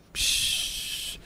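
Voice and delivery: slow, soft hiss of air; awed "pshhh". Real, not acted. Preacher marvelling that it is all from Heaven, hissing exhale. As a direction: let a long voiceless breath hiss out. Wordless sound of awe.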